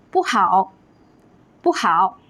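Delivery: 不好 with 不 not rising; original tone on 不. Shown as a demonstration. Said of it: "不 keeps its original first tone".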